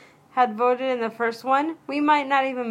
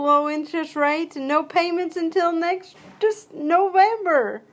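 higher-pitched pretend ad pitch; lightly voiced store commercial; mock sale announcer; 5 semitones higher than usual. Pitching no payments to shoppers, mock announcer voice.

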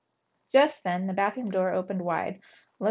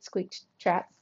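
ordinary delivery, nothing marked

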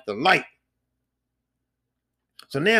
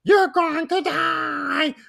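angry monster lady; 13 semitones higher, slower than usual. Retold or imitated. Lady Dimitrescu furious at the player for killing her daughters, high shriek. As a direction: scream it as an enraged vampire mother. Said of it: "She was like".

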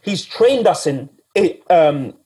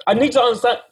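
normal talking voice

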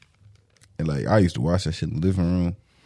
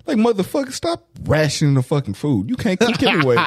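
mock rant; 11 semitones higher than usual. Ranting at the stepmom in retelling.

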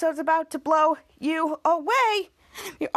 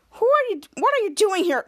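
high, pressing questions; mock interrogation; 5 semitones higher, 4 dB louder than usual. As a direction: act out grilling an intruder. Acted interrogation of intruder.

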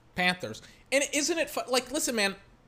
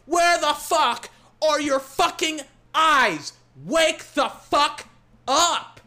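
slow, loud, higher furious yell; angry yell; 5 semitones higher, 4 dB louder, slower than usual. Real, not acted. Fan cursing the blind referee, slow angry yell. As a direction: drag every word out in rage.

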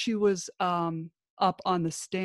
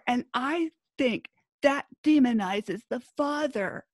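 mousy grinding voice; 4 semitones higher than usual. Retold or imitated. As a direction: mimic the ex-wife's grinding whine. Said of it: "mousy grinding voice".

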